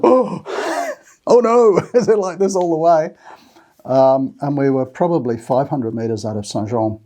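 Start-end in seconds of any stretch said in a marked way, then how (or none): 0.0-3.1 Pat dismayed. Retold or imitated. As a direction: laugh out a mock groan at the steep climb. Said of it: "she was going".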